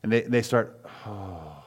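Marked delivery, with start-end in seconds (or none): 1.0-1.5 long, very soft breathy sigh; acted sigh, mostly breath; imitated child's sigh. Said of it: "Sometimes it starts with a sigh".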